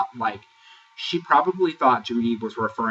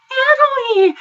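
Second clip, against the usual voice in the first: put-on shrieked high like a starstruck little girl; squealing excited greeting; 10 dB louder than usual. Recapper mocking Jada's excited greeting to Natalie. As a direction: squeal the name like meeting a pop idol. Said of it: "like a little girl who just fucking met Hannah Montana".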